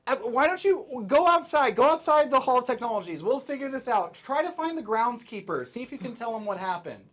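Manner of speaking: high, loud, hurried coaxing; urgent instructions. Acted. Companions send Jeevee to find the groundskeeper, urgent and loud. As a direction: shoo a worried friend out, loudly.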